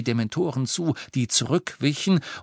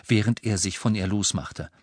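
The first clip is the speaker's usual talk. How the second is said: usual speaking voice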